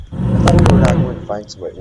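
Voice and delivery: ordinary talk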